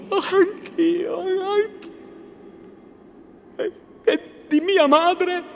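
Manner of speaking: high, tearful female voice; high-pitched anxious pleading; trembling young woman. A narrator's reading. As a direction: answer on the edge of tears. Lucia trembling at the reunion.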